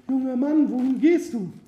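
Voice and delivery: very high falsetto woman's voice; high-pitched damsel calling out; young woman calling. A narrator's reading. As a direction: call him over in a high, put-on female voice. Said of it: "Sie rief ihn zu sich".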